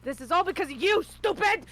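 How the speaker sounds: angry tirade building to high, loud yelling; scold, rising to a loud threat; furious scolding. Acted. Healer shoving diamonds into a dying friend, furious scolding. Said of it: "shoves the diamonds into his chest and goes".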